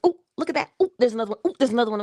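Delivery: high, bubbly gasps of discovery; playfully hammed-up surprise, pitched high; mock amazement. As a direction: marvel at each new arrival popping up. Acting delighted reaction to incoming notifications.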